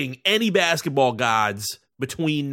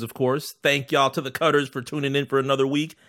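normal talking voice

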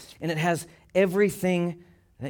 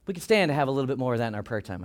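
ordinary talk

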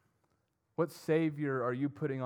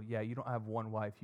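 ordinary talk

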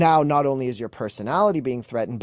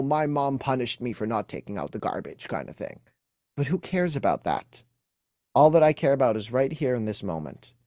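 normal talking voice

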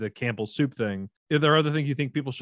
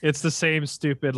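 usual speaking voice

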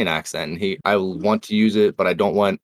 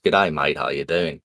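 Australian accent; 6 semitones lower than usual. Shown as a demonstration. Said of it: "English and Australian are very similar".

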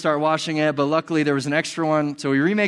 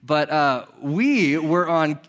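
normal talking voice

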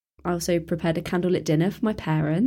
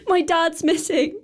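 higher, slower, breaking confession; distraught; 9 semitones higher, slower than usual. Acted. Girl tells neighbour her dad is missing, near tears.

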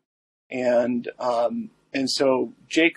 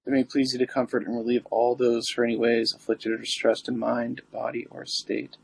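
prayer. Acted. Recites the litany.

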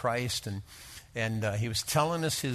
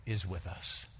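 normal talking voice

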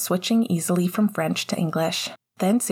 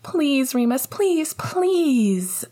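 put-on little boy's begging, pitched up and pushing; 8 semitones higher, much slower than usual. A narrator's reading. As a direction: plead over and over like a small boy. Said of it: "Julian begged".

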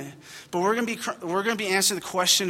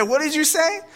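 higher, incredulous retold challenge; mock outrage, pitched up; 10 semitones higher, 3 dB louder than usual. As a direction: demand it in disbelief. Retold confrontation with rude child.

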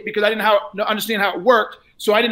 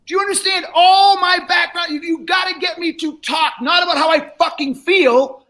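yelling; 5 semitones higher than usual. Real, not acted. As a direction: yell the point home. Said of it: "And I'm yelling at you".

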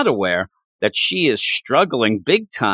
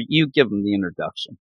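usual speaking voice

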